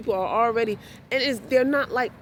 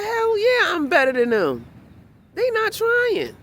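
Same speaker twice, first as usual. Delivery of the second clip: husband's loud boast; 13 semitones higher, 5 dB louder than usual. Retold or imitated. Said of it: "He said".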